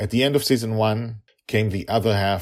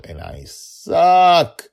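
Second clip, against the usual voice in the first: self-mocking exclamation; 10 semitones higher, 9 dB louder, much slower than usual. Real, not acted.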